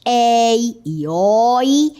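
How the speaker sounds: "ei e oi" very slow, vowels open and held; open diphthongs. Shown as a demonstration. Teacher reminding students of the rule, long open vowels. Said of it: "com ditongos abertos".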